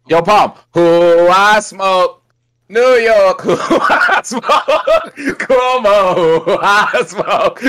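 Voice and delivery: laughing through the words; giggling, high and slow. Real, not acted. Laughing while teasing the smoke.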